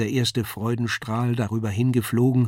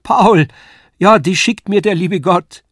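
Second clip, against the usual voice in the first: high, loud cry of joy; jubilant cry; 8 semitones higher, 9 dB louder than usual. Acted. Lisei rejoices that God sent Paul, jubilant loud cry. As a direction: let it fly like a shout of jubilation. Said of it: "wie ein Jubelruf flog das Wort".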